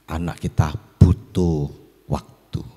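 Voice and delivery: usual speaking voice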